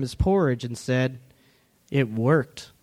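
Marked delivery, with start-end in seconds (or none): 1.9-2.7 sly. A narrator's reading. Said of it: "smiling slyly at Sister Bear".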